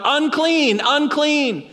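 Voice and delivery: high called-out warning; yelling out. Acted. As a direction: call it out to keep people away. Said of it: "he starts yelling out".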